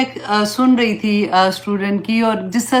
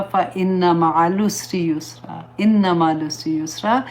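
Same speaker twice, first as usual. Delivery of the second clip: gentle recited verse, softer than her talk; soft Quran recitation; 4 semitones lower, 4 dB quieter than usual. Acted. Teacher comforting students with a softly recited verse.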